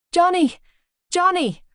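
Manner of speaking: high reporter calling out. A narrator's reading. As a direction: shout for his attention. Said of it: "called out".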